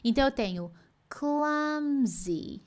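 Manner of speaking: clumsy slowly with a smiling spread i. Shown as a demonstration. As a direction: stretch clumsy with a smiling i at the end. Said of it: "com o i sorridente".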